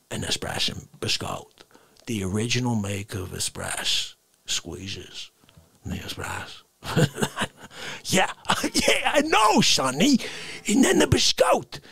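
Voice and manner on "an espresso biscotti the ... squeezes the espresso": much lower, soft dreamy whisper. Acted. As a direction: breathe it like heavenly secret.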